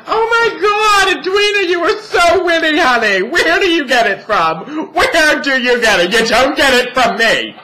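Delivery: high, loud, gushing mock-mom voice; gushing mother. Drag queen imitating her mother gushing over her wit, high and loud. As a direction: shriek the praise like a doting mother. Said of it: "She's like".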